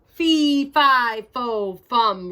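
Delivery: loud, high-pitched roared chant; angry giant chasing Jack, roaring. Acted. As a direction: roar each word as the giant. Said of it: "roared the giant".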